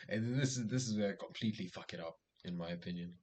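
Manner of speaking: ordinary talk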